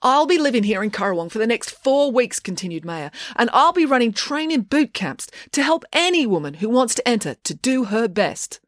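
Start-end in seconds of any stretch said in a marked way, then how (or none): 0.0-2.4 higher-pitched address to a crowd; projected, confident speech-making; public announcement. A narrator's reading. Maya continues her speech. 3.4-8.6 high-pitched rallying invitation; uplifting speech-making, pitch raised; public announcement. A narrator's reading. Maya continues her speech.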